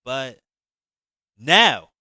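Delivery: loud sharp cut-in; interrupting. Acted. Javon cutting Shantae off, loud and sharp. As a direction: cut her off hard. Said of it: "I interrupted".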